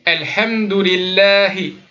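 Alhamdulillahi slowly, final hi clearly sounded; careful recitation demo. Shown as a demonstration. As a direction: give the hi its full weight. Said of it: "Il faut que ce soit prononcé le hi".

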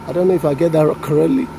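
ordinary talk, nothing marked

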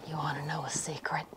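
soft unvoiced confession; whispered secret. Founder confessing to the interviewer he bought beans, whispering. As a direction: lean in and breathe it like a secret.

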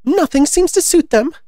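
high, loud desperate complaint; desperate, sobbing. A narrator's reading. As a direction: despair in a high storybook pigeon voice. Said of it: "desperately with a kind of sob".

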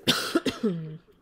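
loud hacking cough; real coughing fit. Real, not acted. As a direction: cough hard, then apologize. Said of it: "sorry, I keep coughing".

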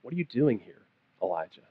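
quiet, intimate divine question; voice of God. Acted. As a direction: ask it gently, almost under the breath. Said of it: "a voice came to him and said".

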